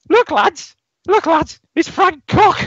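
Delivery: shrill, very high mocking holler; high-pitched accented shout. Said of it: "in his high-pitched accent shouts".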